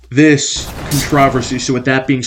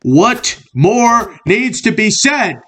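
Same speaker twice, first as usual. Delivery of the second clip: mock heated; 8 semitones higher, much slower than usual. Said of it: "I was getting a little heated".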